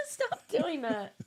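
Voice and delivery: high, slow, half-laughing whine; teary laughing protest. Real, not acted. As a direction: protest while laughing and tearing up. Moved by a voicemail.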